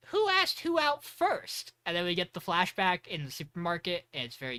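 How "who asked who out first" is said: curious Shin. Retold or imitated. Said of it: "and he goes".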